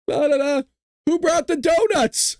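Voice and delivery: breezy chatter before the meeting; mock meeting chatter. Mock meeting chatter, sing-song.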